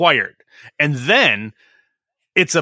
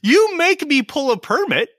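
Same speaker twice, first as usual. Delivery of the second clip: much higher, indignant mock yell; mock furious outburst; 10 semitones higher than usual. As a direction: fire back at the city in outrage. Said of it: "So I'm like".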